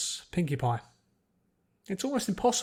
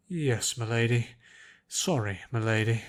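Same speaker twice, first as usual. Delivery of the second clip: put-upon butler; 4 semitones lower, 3 dB louder than usual. A narrator's reading. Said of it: "an old butler who appeared very put upon".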